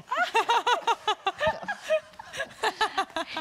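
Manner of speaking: high, loud burst of laughter; big laugh. Real, not acted. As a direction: laugh out hard at herself. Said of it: "That was amazing".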